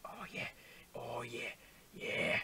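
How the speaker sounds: low, whispery sultry crooning; mock sultry. Player admiring the makeover, mock sultry. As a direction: purr it softly, hamming up the seduction.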